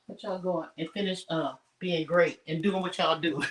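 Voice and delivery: ordinary talk, nothing marked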